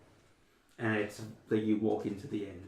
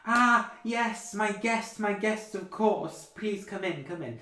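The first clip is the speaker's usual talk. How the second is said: much higher, loud, gushing grand lady; grand hostess; 11 semitones higher, 7 dB louder, slower than usual. Acted. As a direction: welcome them in like royalty. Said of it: "she goes".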